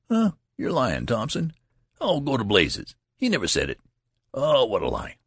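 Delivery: put-on bashful young soldiers' disbelief; youthful scoffing. A narrator's reading. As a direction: wave off praise as a lie. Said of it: "these youthful scoffing and embarrassment".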